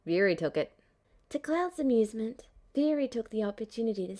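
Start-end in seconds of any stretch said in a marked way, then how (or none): 0.0-0.7 lower, flat deadpan delivery. Acted. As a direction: say it with no expression at all. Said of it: "in a perfectly deadpan voice".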